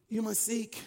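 ordinary talk, nothing marked

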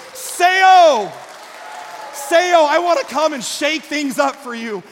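high, full-force preacher's cry; proclaiming shout. Acted. Preacher voicing Jesus to the congregation this morning, proclaiming shout. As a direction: shout it out, then keep proclaiming loudly.

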